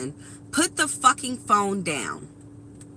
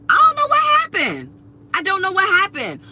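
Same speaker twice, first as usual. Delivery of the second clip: high, loud, rapid cry of disbelief; exasperated, voice leaping up and rushing; exasperated outburst; 8 semitones higher, 6 dB louder, faster than usual. Real, not acted. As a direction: throw your voice way up in bewilderment. Exasperated rant about lost social skills.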